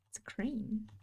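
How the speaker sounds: creepy whisper. Shown as a demonstration. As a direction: breathe the words like a secret. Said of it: "if I say it like this".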